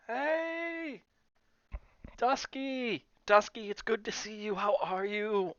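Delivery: high, loud, delighted shout; excited greeting. Real, not acted. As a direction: greet a friend with joy. Greeting a chat friend excitedly.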